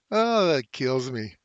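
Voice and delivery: normal talking voice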